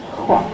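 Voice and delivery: soft "Hua" with a puff of H first; "Hua" begun on a felt breath; breathy H onset. Shown as a demonstration. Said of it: "a little bit of an H sound".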